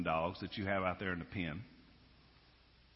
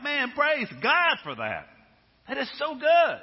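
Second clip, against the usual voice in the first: much higher, louder, slowed exultant cry; joyful praise; 10 dB louder than usual. Pretend. Believer praising God for another's success, exultant. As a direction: celebrate someone else's win with glee. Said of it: "you're like".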